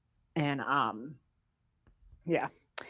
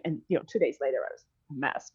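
ordinary talk, nothing marked

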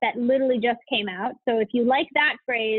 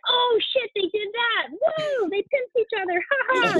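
high-pitched, whooping spectator; thrilled mock outburst in a high voice; excited reaction; 9 semitones higher, slower than usual. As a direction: react with gleeful, drawn-out oohs and woos. Acted excited audience reaction.

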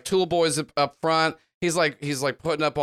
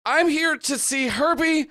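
desperate bystander begging for his life; man begs the gang not to kill him; frantic plea; 8 semitones higher than usual. Acted. Lift blurts desperate plea.

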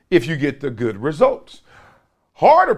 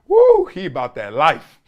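ordinary talk, nothing marked

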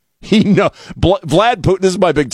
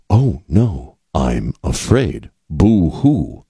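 lower, very slow, sneering fake fright; snotty mock fear; 5 semitones lower, much slower than usual. Host imitates the translator mocking American threats, sneering and slow. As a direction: drag it out like a smug bully pretending to be scared. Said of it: "like he's being snotty".

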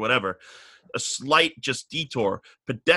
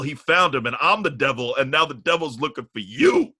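ordinary talk, nothing marked

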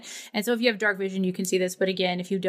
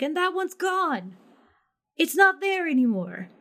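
NPC voice; 7 semitones higher than usual. Acted. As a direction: point at the vanished star, solemnly. GM voices NPC pointing at sky.

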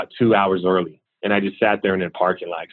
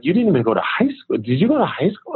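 higher, quicker, puzzled questioning; confused; 9 semitones higher than usual. Retold or imitated. Interviewer puzzled by the empty resume, confused. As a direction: imitate a baffled interviewer in disbelief. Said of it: "confused 'cause there's no information".

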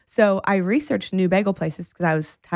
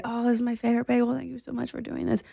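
put-on grateful boyfriend; 4 semitones higher, 6 dB quieter, faster than usual. Voicing the boyfriend's grateful reply.

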